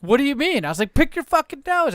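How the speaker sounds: high, pushy retold squabble; imitating the argument with his wife; mock bickering. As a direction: needle her like in the car.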